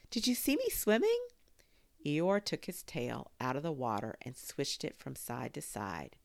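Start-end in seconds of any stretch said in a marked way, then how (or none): 0.1-1.3 high, loud squeaky little voice; a tiny joey showing off; little Roo squeaking with pride; squeaked. A narrator's reading.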